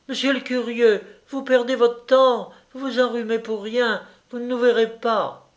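put-on girlish taunt, pitched well up; taunting call. A narrator's reading. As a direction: call out mockingly to someone hiding. Taunts the hidden onlooker.

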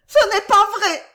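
very high, loud spinster's shriek; piercing acted female protest; indignant cry. A narrator's reading. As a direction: shriek the denial in outrage. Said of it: "s'écria la tante demoiselle, son indignation".

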